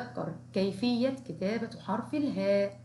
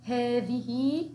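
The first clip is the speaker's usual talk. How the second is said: slow teacher modelling; slow drawn-out model word; 3 semitones higher, much slower than usual. Shown as a demonstration. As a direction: stretch each sound slowly. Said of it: "نكرر مرة أخرى".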